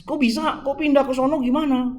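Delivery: high, outraged questioning; indignant protest. Acted. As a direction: demand an answer, voice shooting up.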